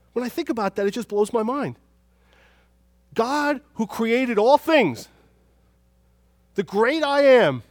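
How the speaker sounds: high, loud, building to slow proclamation; awestruck preaching. Real, not acted. Preacher marveling at God who created all things, impassioned. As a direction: ring out each title with full force.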